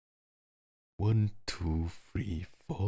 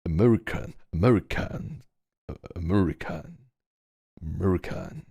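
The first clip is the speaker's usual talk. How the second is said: mock American accent. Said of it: "Do I sound American".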